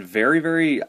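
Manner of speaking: ordinary delivery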